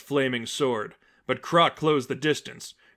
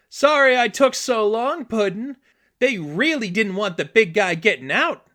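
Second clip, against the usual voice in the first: high, bubbly Brooklyn-style moll; Harley Quinn voice; 9 semitones higher, 5 dB louder than usual. Acted. Harley apologizes to the Joker for being late, high and bubbly. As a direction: chirp it like a giddy sidekick.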